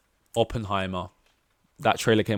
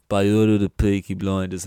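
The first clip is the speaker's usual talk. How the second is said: louder, gruff gangster line; Cillian Murphy impression; 8 dB louder than usual. Fan quoting the show's hero, louder menacing gangster voice. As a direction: deliver it like a menacing crime boss. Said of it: "and I'm like".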